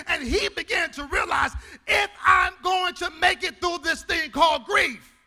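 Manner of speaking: loud, rising, half-sung preaching; preaching at full force. Real, not acted. Pastor to congregation, loud half-sung preaching. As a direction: build the church up. Sermon building to whoop.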